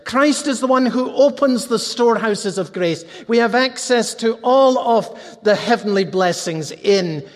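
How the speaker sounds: impassioned pulpit appeal; full-force preaching. Real, not acted. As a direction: drive the invitation home to the congregation.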